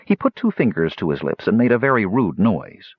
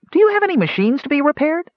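much higher, small gnome voice, thoughtful and eager; thoughtful gnome Flash; 3 dB louder, faster than usual. Acted. Gnome Flash offering the dragon repairs, high and thoughtful. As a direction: pitch way up into a tiny gnome offering to help. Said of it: "asked Flash thoughtfully".

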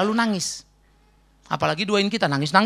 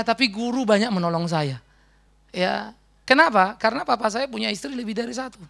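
ordinary delivery

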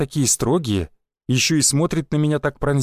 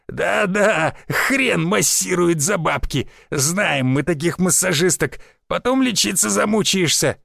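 mocking old man; 4 semitones higher, slower than usual. Old man jeering in tavern.

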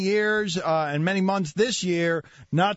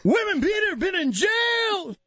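high accented mock shout; one-note rant impression; 11 semitones higher than usual. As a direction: holler it like a ranting dad. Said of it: "it's one note and he keeps".

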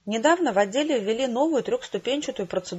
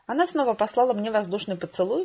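ordinary delivery, nothing marked

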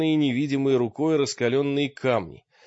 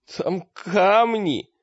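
high, drawn-out whine; tearful, broken voice; 8 semitones higher, 4 dB louder, much slower than usual. A narrator's reading. Battered Таргитай reports to friends, tearful wail. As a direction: wail it slowly, near tears. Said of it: "сообщил он плачущим разбитым голосом".

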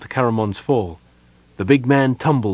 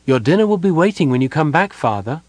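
higher, brisk voice of a daughter; voiced daughter; 7 semitones higher, 4 dB louder than usual. A narrator's reading. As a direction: call to father in a lifted, quick voice. Narrator voices Caramon's daughter.

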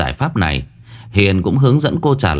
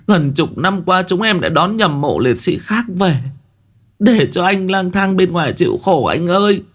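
anguished wailing; 9 semitones higher than usual. A narrator's reading. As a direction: cry out to a dead brother in grief. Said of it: "Cô Trà đọc bảng thông tin mà ngã khuỵu".

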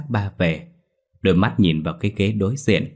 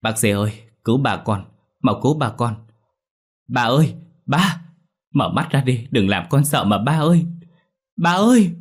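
panicked scream, building; 5 semitones higher than usual. A narrator's reading. Daughter calling the doctor to save her father, rising to a panicked scream. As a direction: panic mounting with every plea until the final cry. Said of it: "Tôi sợ hãi hét toáng lên".

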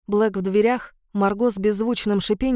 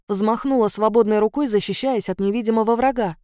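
usual speaking voice

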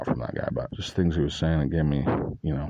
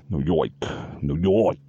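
put-on old-world New York gangster pronunciation; New York gangster accent. Shown as a demonstration. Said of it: "old world New York gangster".

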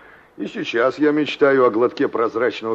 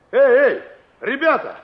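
loud high shout across a distance; calling out; 13 semitones higher, 7 dB louder than usual. Acted. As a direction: yell after the kids. Кричит детям вдалеке. (громкий оклик издалека)

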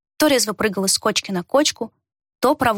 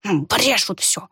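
put-on old man's slow dismissive grumble; slow indifferent grumble; slower than usual. A narrator's reading. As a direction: wave it off slowly, feigning indifference. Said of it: "сделанно равнодушно пробурчал старик". (нарочито равнодушное ворчание)

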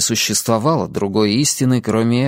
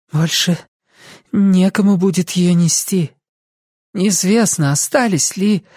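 higher, earnest appeal; persuading young woman; 7 semitones higher than usual. A narrator's reading. Lucy warning the faith may die, earnest. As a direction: appeal to him, leaving it unfinished. Lucy argues to stop Ansel.

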